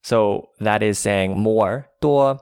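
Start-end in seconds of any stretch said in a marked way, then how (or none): 1.9-2.4 多 held high and level; first tone. Shown as a demonstration. Teacher models the word for more, 多 in high level tone. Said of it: "D U O in the first tone".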